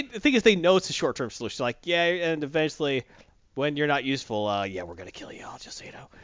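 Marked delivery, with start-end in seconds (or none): 4.5-6.1 low whispered admission; confiding aside. Acted. Mock bosses quietly admitting the betrayal, whispered aside. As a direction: drop to a near-whisper, as if slipping it in.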